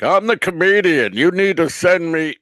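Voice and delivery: loud, high mock entitlement; demanding comedian. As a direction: bellow it like a self-important act. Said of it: "Those are the assholes who get fired".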